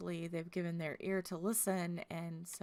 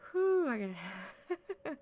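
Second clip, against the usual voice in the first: fighting back tears; 5 semitones higher, 7 dB louder, much slower than usual. Real, not acted. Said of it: "I'm gonna start crying".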